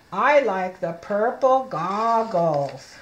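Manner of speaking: slow again, each word set apart; slow model reading. Shown as a demonstration. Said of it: "Look at me".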